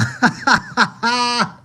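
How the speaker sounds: loud, high cackling laughter; long helpless laughing fit; laughing hard. Real, not acted. Streamer loses it over a dumb fight quote, laughing loudly. Cracks up at Elsa line.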